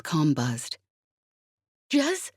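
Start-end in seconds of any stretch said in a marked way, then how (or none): 1.9-2.3 urgent high call, voice tight with panic; frantic. A narrator's reading. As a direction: call her name in alarm. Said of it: "he sounded frantic".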